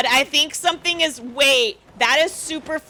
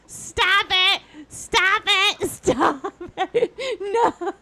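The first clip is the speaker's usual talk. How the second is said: laughing disbelief; 5 semitones higher, much slower than usual. Real, not acted.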